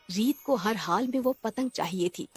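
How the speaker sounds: ordinary delivery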